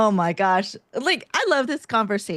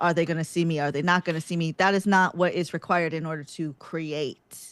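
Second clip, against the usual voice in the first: ordinary talk, nothing marked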